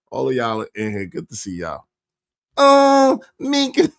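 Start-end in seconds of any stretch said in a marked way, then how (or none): 2.6-3.9 higher, louder mock coo. Host mocking Anton's supposed sweet-talk, higher and louder. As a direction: swoon the name in mockery. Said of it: "does not talk like".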